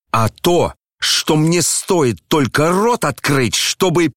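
high, drawn-out threat, left unfinished; sudden wail. A narrator's reading. Son threatening to reveal his father's secret, sudden wail. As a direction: climb high and break off mid-threat. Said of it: "завопил вдруг Ганя и не договорил".

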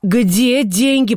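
high, very slow, stretched demand; escalating, high-pitched demand; insistent angry cry. A narrator's reading. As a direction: wail the question out word by word. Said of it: "повторила она".

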